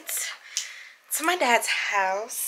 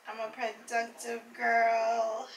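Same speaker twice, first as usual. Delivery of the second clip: slow, sing-song self-praise; drawn-out repeat; 9 dB quieter, slower than usual. Real, not acted. Vlogger praising herself after editing, slow and sing-song.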